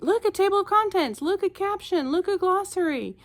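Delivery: put-on high, eager kid voice; gasping with discovery like a child; excited kids. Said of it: "they were really excited about it".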